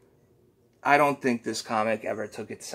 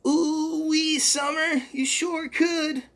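much higher, slow cartoon squeak; very high cartoonish creature voice; Mister Poopy Butthole; 6 dB louder, slower than usual. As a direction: chirp it out like a goofy sidekick.

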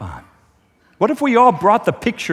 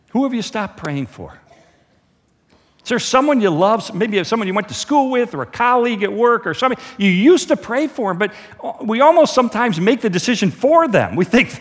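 ordinary talk

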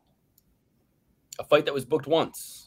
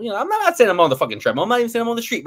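fast, much higher, louder insistence; heated bragging; 10 semitones higher, 5 dB louder, much faster than usual. Real, not acted. Insisting to co-hosts about his workout, high and fast. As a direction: push it up, almost yelling.

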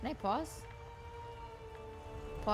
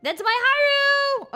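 high, slow delighted squeal; gushing squeal; 12 semitones higher, 7 dB louder, slower than usual. Real, not acted. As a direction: squeal over something adorable. Gushing over cute character.

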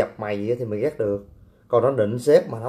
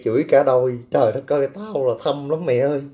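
ordinary talk, nothing marked

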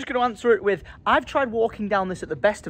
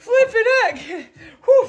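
hammed-up Scottish yelp, higher and slow; mock Scottish exclamation; 5 dB louder, slower than usual. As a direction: blurt it in a silly Scottish accent. Flustered Scottish voice continues.

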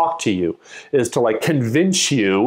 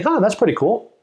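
higher, pleasantly surprised; impressed surprise; 8 semitones higher than usual. Shown as a demonstration. Acted listener reaction again.

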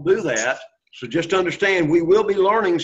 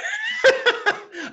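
high laugh. Real, not acted. Sees students smiling.

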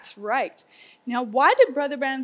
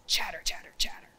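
whisper; 3 semitones lower, 4 dB quieter, faster than usual. Acted. Storyteller hushes for squirrel sound.

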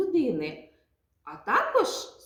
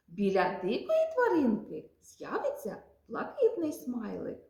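ordinary talk, nothing marked

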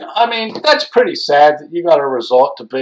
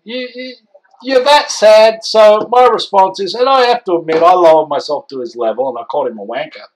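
usual speaking voice

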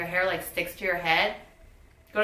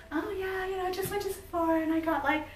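imitating mom; 11 semitones higher, faster than usual. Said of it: "My mom's like".

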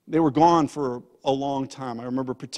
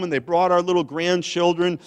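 ordinary delivery

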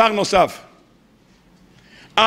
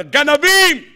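higher, accusing cry of "thieves"; Satan accusing; 4 semitones higher, slower than usual. Retold or imitated. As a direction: hurl the accusation as the prosecutor. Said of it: "אז מה השטן מקטרג?".

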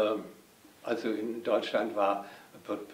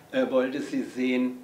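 ordinary talk, nothing marked

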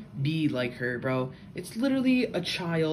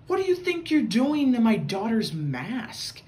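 retold outraged mom, louder and higher; indignant mock yell, pitched up; angry mom; 7 semitones higher, 5 dB louder than usual. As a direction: imitate a mother scolding someone else's kid. Angry mom confronting the kids.

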